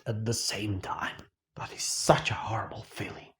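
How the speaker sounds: hushed, breathy despair whisper. Acted. Comic host whispering his despair about being ignored. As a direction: let the words sink into a whisper.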